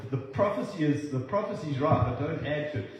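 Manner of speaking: normal talking voice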